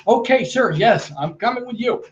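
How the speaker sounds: high, eager imitation of his brisk speech; mirroring his manner. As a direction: echo his hurried, high-pitched energy. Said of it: "I matched and mirrored how he spoke".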